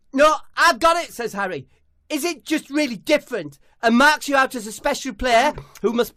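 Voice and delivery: high, loud comic banter; high loud sketch voices; high loud footballer voices. Acted. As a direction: throw it out eagerly. Harry, Debbie.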